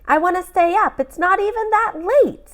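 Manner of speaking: put-on high pleading little boy voice; boy protesting bedtime. Boy begs his dad to stay up, high and pleading. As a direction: whine like a kid who won't sleep. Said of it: "called Samuel McKay".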